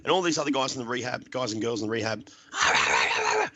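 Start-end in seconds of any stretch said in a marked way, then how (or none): 2.5-3.5 mock shouted gibberish rant; mimicked screaming tirade; imitated screaming. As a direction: rage wordlessly, as if down the phone. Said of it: "I'm screaming at her".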